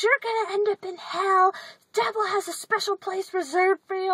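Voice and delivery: imitating preachy lady. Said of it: "and she goes".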